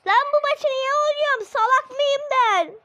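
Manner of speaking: çok ince, yüksek ve hızlı sızlan; tiz sesli söylenme. Pretend. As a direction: kendine kızan komik tiz ses. Ranting at game in high voice. (maçı neden oynadığını sorgulayan tiz sesli karakter)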